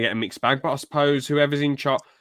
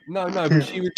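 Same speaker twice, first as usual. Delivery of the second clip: normal talking voice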